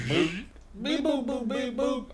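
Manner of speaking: soft, spoken computer beeps and boops; robot reboot beeps. Acted. Robot rebooting after a glitch, soft beeping. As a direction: make small electronic noises with the mouth.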